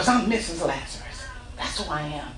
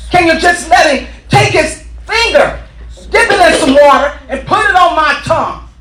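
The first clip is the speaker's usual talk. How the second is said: loud, higher begging plea; pleading yell; 7 semitones higher, 10 dB louder than usual. Retold or imitated. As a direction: beg at full voice. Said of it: "He starts screaming".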